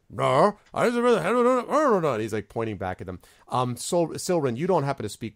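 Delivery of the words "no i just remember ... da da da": high, loud, fast goblin babble; Gleek. Acted. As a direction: babble back at the other goblins. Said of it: "babbling at each other".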